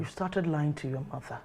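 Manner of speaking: low, soft reproach; softly stern and disappointed; quiet accusation. Acted. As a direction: accuse your son in a hushed, stern voice. Mother accuses son of lying.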